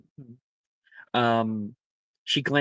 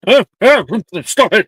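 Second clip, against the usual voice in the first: loud, high startled yelps; hat abruptly woken; 9 semitones higher, 12 dB louder than usual. A narrator's reading. Sleeping hat is shaken awake by a professor, startled shouting. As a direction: yelp in protest as you're shaken awake. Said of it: "The hat stirs awake abruptly".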